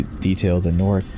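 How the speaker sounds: plain reading voice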